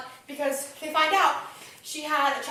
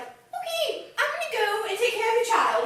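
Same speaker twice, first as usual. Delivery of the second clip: high mock voice; 9 semitones higher than usual. Retelling Valjean's promise.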